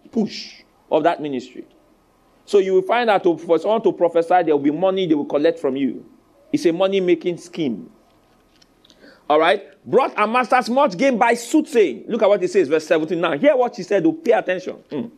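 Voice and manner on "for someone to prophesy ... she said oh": ordinary talk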